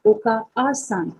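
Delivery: ओका-आ-सान with the long a held; slow teacher demo of ओका-आ-सान; drawn-out ओका-आ-सान. Shown as a demonstration. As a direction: stretch the a in ओका-आ-सान slowly. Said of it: "यहाँ पर pronunciation को".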